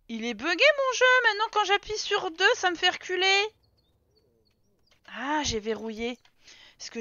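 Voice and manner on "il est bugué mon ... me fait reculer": high, loud protest; exasperated complaint. Real, not acted. Player complaining the game is bugged, exasperated high protest. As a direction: whine loudly at the broken controls.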